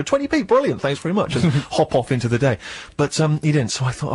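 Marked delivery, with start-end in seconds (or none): 0.0-1.3 high, chirpy mock thanks; imagined grateful reply. Imagining the homeless guy's happy reply, high mock thanks. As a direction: squeak it out as the imagined grateful beggar. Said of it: "I was hoping he would just go".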